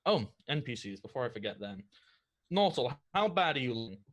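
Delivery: ordinary delivery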